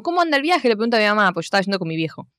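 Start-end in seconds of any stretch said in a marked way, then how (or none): 0.0-0.7 lightly acted, higher-pitched question; a hint of a higher voice; casual mimicked query; imitating sister's message. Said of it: "mi hermana le manda un mensaje como".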